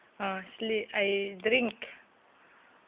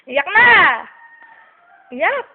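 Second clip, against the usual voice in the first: higher, louder playful call to cry; loud teasing call; 7 semitones higher, 15 dB louder than usual. Real, not acted. Egging baby to cry loudly. (malakas na panunukso sa bata)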